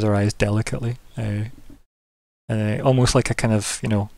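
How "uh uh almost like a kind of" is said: ordinary delivery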